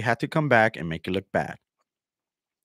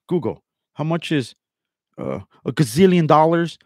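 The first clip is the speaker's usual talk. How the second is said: higher-pitched imitation asking Google; mock dim question; 6 semitones higher, 7 dB louder than usual. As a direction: play him cluelessly querying, louder on the number. Mimicking rival asking Google.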